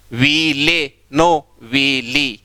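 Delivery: वीले very slow with e, then वीली with ee; veele versus veeli. Shown as a demonstration. Teacher contrasting syllables for the class, very slowly. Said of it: "तीनों में ली हो रहा है".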